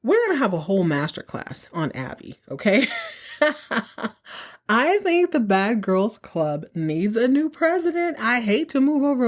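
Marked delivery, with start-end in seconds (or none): 2.9-4.1 real laugh. Real, not acted. Laugh after Abby quip.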